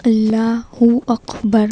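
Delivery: slow اللہ اکبر with long vowels in اللہ; correct pronunciation. Shown as a demonstration. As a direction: model اللہ اکبر word by word, drawn out. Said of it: "تو یہ صحیح pronunciation ہے".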